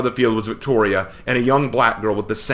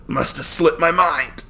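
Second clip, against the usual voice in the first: spat back; 7 semitones higher, slower than usual. A narrator's reading. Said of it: "I spat back".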